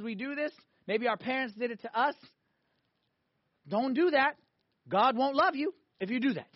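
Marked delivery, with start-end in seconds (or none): 3.7-6.5 lightly acted parental warning; a hint of a stern parent, pitched up; scolding parent. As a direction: warn a child off, mildly scolding. Voicing a parent warning a child.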